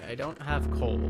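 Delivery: ordinary delivery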